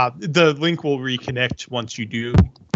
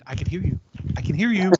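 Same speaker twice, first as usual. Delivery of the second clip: soft little voice, rising higher on the repeat; 5 semitones higher, 3 dB quieter, faster than usual. Acted. Game master voices a tiny voice from the jacket, softly. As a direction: lightly play a small, quiet voice. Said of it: "you hear a little voice say".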